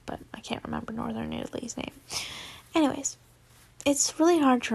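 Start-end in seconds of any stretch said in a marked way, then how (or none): 0.1-1.9 fast, soft whispered aside. Real, not acted. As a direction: whisper it to yourself, quickly. Aside muttered under breath.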